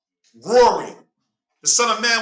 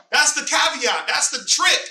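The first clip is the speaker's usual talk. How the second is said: ordinary delivery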